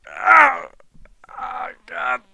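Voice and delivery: high pained cry, then weakening; high-pitched groaning in mock agony; acted pain. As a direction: cry out as if knocked into a cage. Knocked-down fighter cries out in pain.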